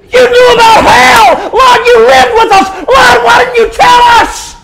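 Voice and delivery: high, anguished full-force scream; gut-wrenching cry. Acted. Men of Sodom blaming Lot, gut-wrenching scream. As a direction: wail an accusation at the top of your voice. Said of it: "a horrible, gut-wrenching cry".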